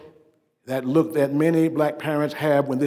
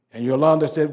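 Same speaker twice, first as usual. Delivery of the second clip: ordinary talk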